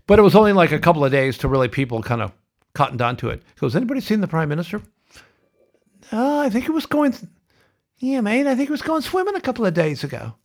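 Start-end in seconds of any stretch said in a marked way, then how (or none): 3.8-4.8 quick, much higher put-on Aussie accent; a lightly hammed-up Aussie accent, fast; bad Aussie accent. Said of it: "excuse that bad Aussie accent before". 6.1-10.3 very high, lazy put-on Aussie accent; a hammed-up Aussie bloke accent, pitched way up; bad Aussie accent. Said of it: "excuse that bad Aussie accent before".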